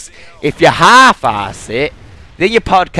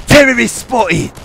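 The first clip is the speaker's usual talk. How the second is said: higher, slow announcing callout; soldier callout; 10 semitones higher, 4 dB louder, slower than usual. Retold or imitated. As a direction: call it out like a game soldier spotting an enemy. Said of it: "they do shit like that".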